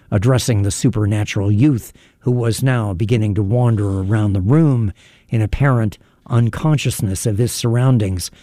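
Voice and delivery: plain reading voice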